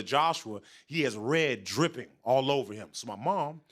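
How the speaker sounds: ordinary delivery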